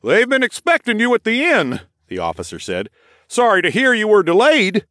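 high, loud, compliant; dazed officer voice. A narrator's reading. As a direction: repeat the suggestion obediently.

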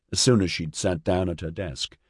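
plain reading voice

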